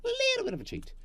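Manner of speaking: very high squeaky cheeky voice. Pretend. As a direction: squeak it like a mischievous imp.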